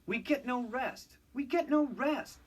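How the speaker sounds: whiny, rising grumble; complaining engines. A narrator's reading. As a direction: moan it like tired engines. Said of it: "they complained".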